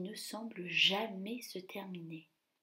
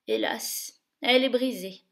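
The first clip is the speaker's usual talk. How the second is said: dismayed duke; 8 dB louder than usual. Acted. Grand duke mourning the slipper, dismayed. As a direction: sigh over the loss.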